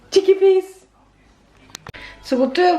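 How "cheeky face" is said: high, louder cooing to a little one; sing-song fond teasing. Real, not acted. Teasing the small child.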